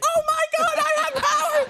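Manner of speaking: high, loud squealing joy with laughter; laughing screaming disbelief; excited shriek. Retold or imitated. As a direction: shriek it like you just found out you have powers. Reenacting excited discovery.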